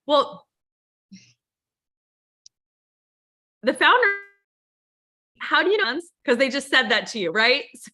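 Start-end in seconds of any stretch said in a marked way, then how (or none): 0.1-6.0 higher, louder naive newbie voice; mock newbie reply. New recruit answering mentor's question, high naive mock voice. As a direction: play the clueless new recruit, pitched up. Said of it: "She's like".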